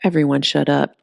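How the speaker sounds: ordinary delivery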